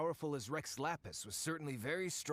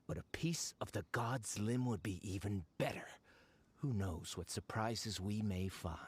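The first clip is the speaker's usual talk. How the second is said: whispered greedy aside, lower; 6 semitones lower than usual. Acted. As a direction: breathe it as a secret to yourself.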